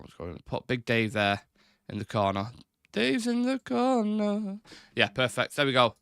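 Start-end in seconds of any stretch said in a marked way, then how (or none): 2.9-4.6 high, slow and drawn-out, teasing; silly sing-song voice. Pretend. Streamer announcing Dave's on-screen spot to viewers, in a high slow silly voice. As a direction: stretch it out like a playful announcement. Playful repeat placing Big Dave.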